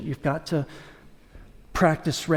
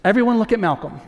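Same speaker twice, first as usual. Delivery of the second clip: higher, exasperated call to order; exasperated teacher; 6 semitones higher, 4 dB louder than usual. Retold or imitated. Teacher calling unruly kindergartners to attention, exasperated. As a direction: call out to a rowdy class, fed up. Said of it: "was exasperated because the kids were acting crazy".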